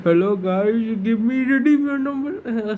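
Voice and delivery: high, hammed-up scam call centre voice; mock Indian tech support caller; tech scammer voice. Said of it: "unless you sound like those tech scammer guys".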